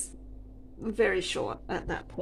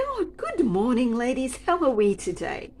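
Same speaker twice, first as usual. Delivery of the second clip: higher, louder bright hello; cheery greeting; 6 semitones higher, 6 dB louder than usual. Retold or imitated. Colleague greeting rude customers, all happy. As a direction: greet them sunny and cheerful. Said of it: "she was all happy".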